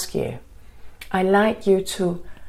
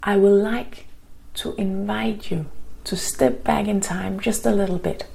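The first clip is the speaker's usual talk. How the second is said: guided meditation. Acted. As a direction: ease them into calm, gently.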